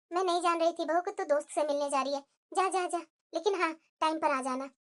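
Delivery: low, soft, conceding voice; meek backing down. Acted. Mother-in-law letting daughter-in-law meet friends, meek and quiet. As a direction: give in quietly and let her go.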